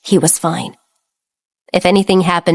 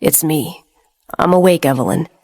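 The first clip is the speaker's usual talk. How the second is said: gentle; 3 semitones lower than usual. Acted. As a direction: reassure her gently. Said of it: "said gently".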